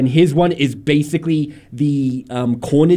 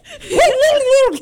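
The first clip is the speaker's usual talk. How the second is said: very high falsetto cartoon hoots; deformed Mickey Mouse squeal; 4 dB louder than usual. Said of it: "a deformed Mickey Mouse being like".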